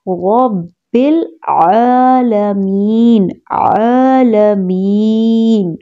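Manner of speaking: رب العالمین slow, thick ra and throaty ain; slow chant of رب العالمین; heavy ra, clear ain. Shown as a demonstration. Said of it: "عین کی آواز کا بہت خیال رکھیں".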